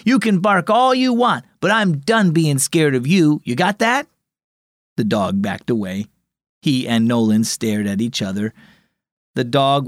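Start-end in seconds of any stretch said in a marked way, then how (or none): 0.0-4.1 higher, raised voice, done being scared; said loudly. A narrator's reading. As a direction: tell the dog off with new courage. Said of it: "he said loudly".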